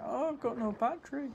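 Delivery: squeaky high whine of a dying laptop; mock laptop voice. Streamer voicing his laptop running out of battery, high mock whine. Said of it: "my laptop going".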